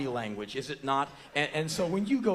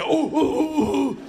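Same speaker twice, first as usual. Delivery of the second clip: very high, loud whoops; crowd whooping; 12 dB louder than usual. Retold or imitated. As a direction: whoop wordlessly in a rising frenzy. Crowd of men yelping.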